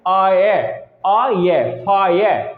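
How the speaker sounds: आय stretched as "aay", then फायर as "faa-yer"; आय, आय, फायर: a drawn-out "aay-er" sound; ire sound. Shown as a demonstration. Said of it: "pronunciation change हो जाती है". ("आय" ध्वनि का उच्चारण)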